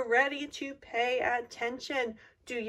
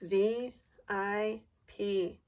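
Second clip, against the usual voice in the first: slow spelling out; 3 semitones lower, slower than usual. Shown as a demonstration. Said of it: "is spelled".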